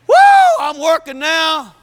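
high, slow, gleeful holler; excited whoop. Acted. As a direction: whoop as the saw finally runs. Acting delight at running chainsaw.

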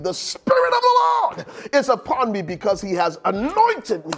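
rising, impassioned pulpit prayer with surging emphasis; driving, heartfelt intercession; fervent preached prayer. Real, not acted. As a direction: call it down on the congregation.

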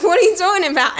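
high, loud, amused protest; incredulous retort. Retold or imitated. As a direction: act out baffled indignation, half laughing. Retold bewildered protest, laughing.

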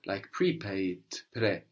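ordinary delivery

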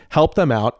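ordinary delivery

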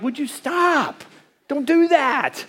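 annoyed protest. Retold or imitated. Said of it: "and I'm like".

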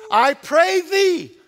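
high, loud, slow imploring prayer; fervent plea. Acted. Pastor voicing the people's plea to God, fervent. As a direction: beg for rescue with full voice.

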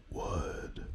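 very soft, voiceless whisper; mock ASMR whisper. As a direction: whisper it like a joke ASMR clip. Breathy joke echo of wood.

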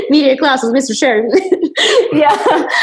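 high giggling; laughing at her own joke. Real, not acted.

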